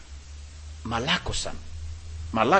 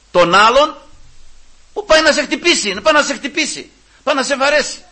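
raised, high-pitched pulpit delivery; impassioned preaching; 12 semitones higher, 8 dB louder than usual. Real, not acted. Preacher urging love for an attacker, impassioned. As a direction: drive each phrase home, loud and high.

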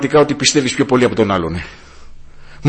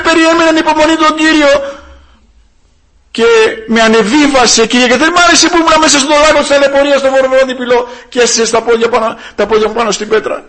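high, raised preacher's voice; full-force preaching; 12 semitones higher, 7 dB louder than usual. Real, not acted. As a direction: drive the exhortation home to the congregation. (έντονο κήρυγμα με υψωμένη φωνή)

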